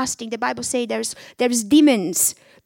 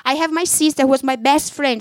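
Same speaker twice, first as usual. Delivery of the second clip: normal talking voice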